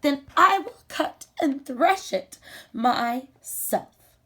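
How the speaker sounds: the little hen deciding to do it alone; determined hen. Acted. Hen answers the refusal.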